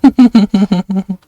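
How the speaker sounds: laughing at own failed attempt. Real, not acted. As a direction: giggle through the words. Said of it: "I can't do it".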